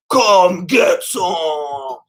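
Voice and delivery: very slow, low, drawn-out growl; hammed-up Duke Nukem taunt; Duke Nukem catchphrase. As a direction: stretch every word like a tough action hero. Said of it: "obsessed with Duke Nukem".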